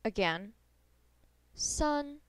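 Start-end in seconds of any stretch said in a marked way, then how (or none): 1.5-2.2 san held high and level; high flat tone on san. Shown as a demonstration. Teacher models number three for learners, high flat san. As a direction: keep san up on one even pitch. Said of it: "Three is a high flat tone".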